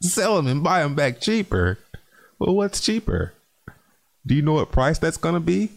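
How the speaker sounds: high-pitched, incredulous questioning; incredulous mockery. Real, not acted. Streamer mocking grandfather's trading plan, high and incredulous.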